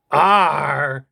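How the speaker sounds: loud creature grunt of Arr. Acted. Agnew the creature replies to host with loud Arr. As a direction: Agnew grunts his point. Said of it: "You're right, Agnew".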